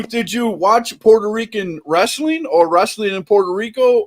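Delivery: normal talking voice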